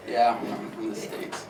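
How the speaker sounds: hushed, slow, embarrassed mumble; sheepish admission. Retold or imitated. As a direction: admit it reluctantly, almost whispered. Mimics sheepish reply to locals.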